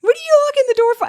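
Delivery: very high, loud protest; mock alarm. Mimicking someone trapped by a man in business, high mock alarm. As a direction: cry out in exaggerated panic.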